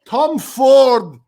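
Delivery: a wobbly put-on Australian accent drifting toward Scottish; shifting mock Australian accent. Shrek chats with the show host in a shaky mock Australian accent. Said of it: "we're getting you in Scottish, sometimes it's Ukrainian".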